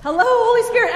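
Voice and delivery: loud. Shown as a demonstration. Pastor calling into the fan for the children, loud. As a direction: belt it into the fan. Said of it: "say it loud".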